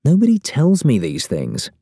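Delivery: mild aggrieved whine; complaining. A narrator's reading. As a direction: gripe that nobody tells you anything. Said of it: "I complain".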